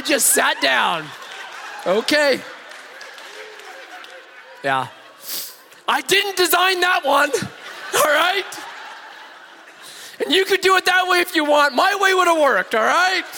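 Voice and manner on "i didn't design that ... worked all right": defensive pride. Acted. Engineer defending his failed plan, huffy high protest. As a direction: sulk like a proud engineer who lost. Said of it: "own wounded pride".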